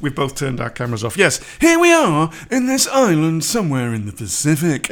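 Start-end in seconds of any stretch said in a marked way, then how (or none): 1.2-4.9 louder travelogue presenter voice; Alan Wicker impression. Copying the old travel reporter, hammed-up presenter voice. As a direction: intone it like a vintage documentary host. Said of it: "everybody impersonated Alan Wicker".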